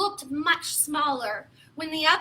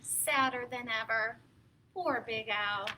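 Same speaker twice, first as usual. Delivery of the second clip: sad storyteller sigh; 7 semitones lower, 9 dB quieter than usual. A narrator's reading. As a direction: feel sorry for Big Al.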